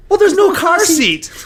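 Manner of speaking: high, slow fussy nanny voice; prissy nanny. Retold or imitated. Nanny fussing about the baby while being kidnapped, high fussy voice. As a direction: fret about the car seat in a high voice.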